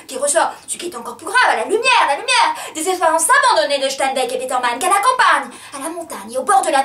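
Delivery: loud, insistent declamation climbing high; swelling, agitated outpouring; building frenzy. Acted.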